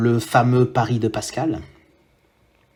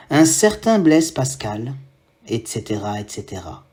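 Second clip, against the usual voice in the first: measured poem reading with long pauses; poem recitation; 3 semitones higher, 5 dB louder than usual. Acted. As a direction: announce and recite a poem, letting each line hang.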